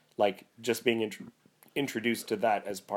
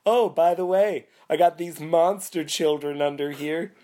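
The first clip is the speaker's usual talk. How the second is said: high, loud breezy offhand tone; cheerful spirit casually revealing monster children; mock-casual spirit; 8 semitones higher, 6 dB louder than usual. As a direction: toss it off like it's no big deal. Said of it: "and then he's just like".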